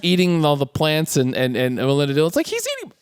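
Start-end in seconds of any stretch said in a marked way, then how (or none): 2.5-2.9 very high, soft mock squeal; imitated thin high-pitched protest. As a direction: squeak it in dismay. Imitating [name]'s horrified cry.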